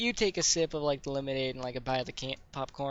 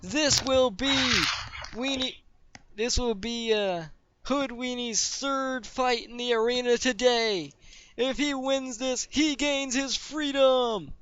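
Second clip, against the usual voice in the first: high, drawn-out fight announcer voice; arena announcer; 8 semitones higher than usual. Acted. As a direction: introduce the fighters to a roaring crowd. Game master plays arena announcer.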